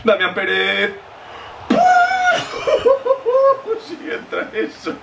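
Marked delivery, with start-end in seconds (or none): 1.7-3.8 shrieking burst of laughter. Real, not acted. Goal chance in FIFA.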